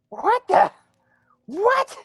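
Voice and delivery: loud, very high-pitched squawk; startled creature. Acted. Creature hears someone calling from below, high startled squawk. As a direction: squawk it in high, indignant disbelief.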